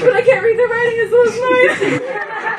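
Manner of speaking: high, whiny fake-tearful complaint; hammed-up tearful whine, slowing down; mock crying. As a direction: wail it like an excuse to cry. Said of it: "Like, cry".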